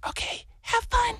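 high, soft sing-song wife; airy high send-off in his wife's voice; imitated wife. Wife sees husband off for his walk, high mock wifely voice. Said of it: "So she's like".

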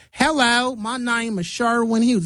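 high-pitched mock Southern accent. Shown as a demonstration. Demonstrating the slowed English accent turned Southern, Southern accent. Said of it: "becomes a Southern accent".

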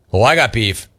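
usual speaking voice

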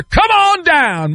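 high, drawn-out announcer call; stretched-out game-show summons. Acted. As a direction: call a contestant down, big and slow.

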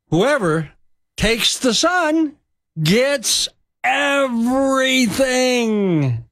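preacher driving home the point; sermon climax. Real, not acted. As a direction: lift "takes the son" high and slow, pause before "everything".

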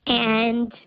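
digitally disguised voice; pitch-shifted anonymous voice; voice changer. Real, not acted. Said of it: "'cause Voice Changer's on".

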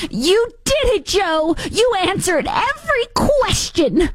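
very high, slow, doting praise; imitating Jill Biden. Host mimicking Jill praising Joe after debate, high gush. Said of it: "you have Doctor Jill after the debate".

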